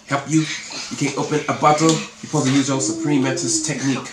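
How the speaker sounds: usual speaking voice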